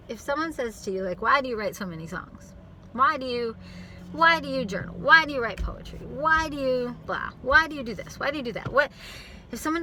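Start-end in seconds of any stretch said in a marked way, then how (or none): 1.2-8.7 rising, louder accusing questions; pushy interrogating tone. Retold or imitated. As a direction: grill them with judgment. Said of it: "let me change my tone".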